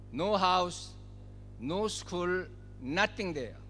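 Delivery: ordinary delivery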